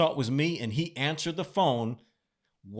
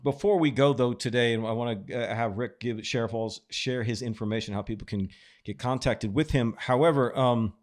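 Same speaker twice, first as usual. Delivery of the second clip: normal talking voice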